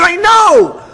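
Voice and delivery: high, loud exasperated reply; yelling back. Retold or imitated. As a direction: shout it back at your wife. Yelling back to his wife.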